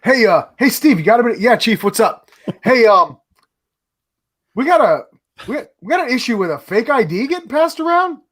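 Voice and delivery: hammed-up office exchange; imagined office scene. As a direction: act out a boss calling someone over. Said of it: "I can just see that manifest".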